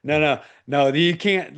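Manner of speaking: pleading refusal through a laugh; laughing protest. Retold or imitated. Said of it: "And I was like".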